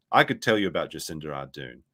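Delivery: usual speaking voice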